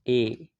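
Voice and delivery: ए held long as "ay"; sounding out ए. Shown as a demonstration. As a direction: model ए as a drawn-out vowel. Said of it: "ए का sound". (ए की लंबी ध्वनि)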